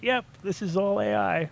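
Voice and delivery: slow, high put-on Indian accent; mock Indian accent. Posing as keyboard workers faking AI, mock Indian accent. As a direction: imitate an Indian worker, pitched high and drawn out. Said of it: "people in India just banging away".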